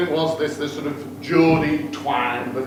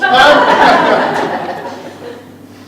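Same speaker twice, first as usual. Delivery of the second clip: burst of laughter; 9 semitones higher, 9 dB louder than usual. Real, not acted. Laugh at the groom's look.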